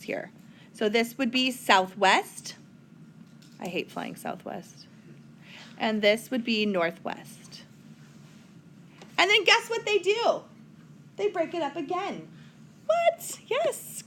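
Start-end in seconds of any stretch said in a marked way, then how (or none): 3.6-4.9 whispered, under the breath; whispered aside. Real, not acted. Teacher whispering a joke aside to the class. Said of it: "aside joke". 12.9-14.0 high mock gasp of surprise. Teacher pretending to be shocked that the compass splits again. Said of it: "mock surprise at subdivision".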